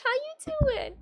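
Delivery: high, squeaky greeting; silly greeting. Acted. Greeting a viewer in a squeaky voice. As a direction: squeak the hello.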